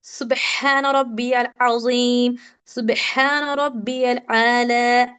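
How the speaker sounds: slow chanted recitation of सुबहान रब्बियल अज़ीम as in salah; prayer recitation. Shown as a demonstration. Teacher recites the prayer phrases as said in salah, slow chant. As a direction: intone it slowly like in prayer. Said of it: "ये तो daily पढ़ते हैं नमाज में".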